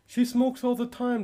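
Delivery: exasperated husband protesting; exasperated objection. Acted. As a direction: push back in disbelief. Father objecting to mother-in-law.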